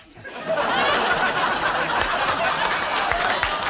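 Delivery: silent high wheezing laugh; real wheezing laugh; breathless wheeze laugh. Real, not acted. Laughs at own joke.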